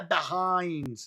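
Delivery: usual speaking voice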